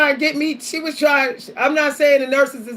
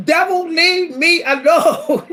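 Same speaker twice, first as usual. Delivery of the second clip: laughing rebuke; 5 semitones higher, 4 dB louder, slower than usual. Real, not acted. Telling off the devil, laughing.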